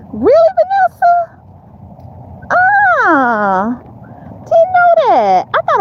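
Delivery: high, drawn-out surprise; surprised. Real, not acted. Streamer learning news from viewer Vanessa, surprised. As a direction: let the pitch leap up in real astonishment.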